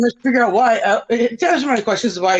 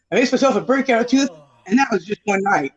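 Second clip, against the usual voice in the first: ordinary talk, nothing marked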